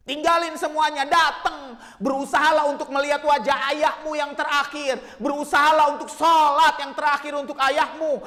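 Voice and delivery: loud, high, sustained exhortation; impassioned preaching. Real, not acted. Preacher urging children to attend father's last rites, shouted exhortation. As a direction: drive each plea home at full force.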